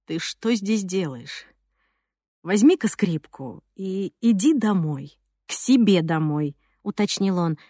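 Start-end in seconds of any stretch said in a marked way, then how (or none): 0.1-6.6 muffled voice. Acted. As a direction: speak low and dull after the blow. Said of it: "голос его звучал глуше".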